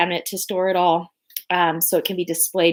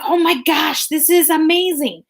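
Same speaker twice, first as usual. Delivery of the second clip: excited gush; 9 semitones higher, 4 dB louder than usual. Retold or imitated. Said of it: "I was like".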